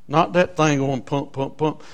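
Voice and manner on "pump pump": ordinary talk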